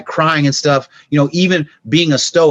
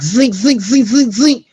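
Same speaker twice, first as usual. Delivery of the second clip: rising, higher and higher "zing" whizzes; bullet zings; 9 semitones higher than usual. Retold or imitated. Describing bullets flying past the car, whizzing zings. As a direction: make each bullet whistle past. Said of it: "and the bullets went like".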